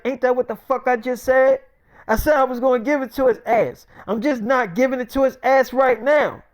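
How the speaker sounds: high-pitched, testy comeback; acted exasperated rant; irritated retort. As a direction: snap back, fed up with being questioned. Retold character snapping at questioner.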